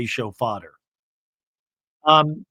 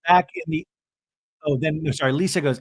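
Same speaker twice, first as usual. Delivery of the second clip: ordinary talk, nothing marked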